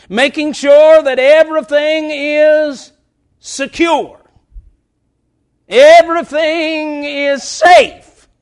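loud, high-pitched preacher's cry; thundering warning from the pulpit; full-force preaching. Real, not acted.

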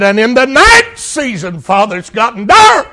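Jesus crying out in the dark; anguished cry of Jesus. Acted. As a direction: swell into a desperate cry. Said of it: "Jesus said".

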